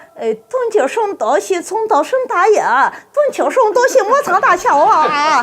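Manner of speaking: high mock-Chinese babble; Chinese street vendor. Vendor explains the dish to a tourist, high mock-Chinese patter. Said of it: "The guy goes".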